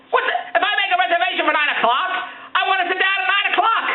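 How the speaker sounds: indignant rant. Real, not acted. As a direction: demand to be seated on time. Ranting about being seated.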